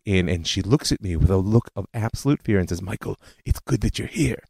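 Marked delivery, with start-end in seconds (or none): 2.8-4.4 put-on thick Eastern European accent, softer and fearful; great aunt's Eastern European accent, hushed and afraid. Great aunt warning her nephew, thick Eastern European accent. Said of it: "in her thick Eastern European accent".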